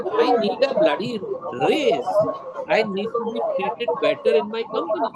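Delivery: frustrated demand. Retold or imitated. Voices secretary's demand to her boss.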